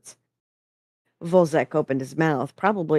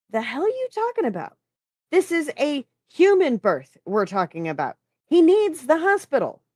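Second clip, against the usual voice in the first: high-pitched, incredulous outburst; indignant protest; 11 semitones higher, 4 dB louder than usual. A narrator's reading. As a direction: argue back in high exasperation. Acted quarrel, incredulous objection.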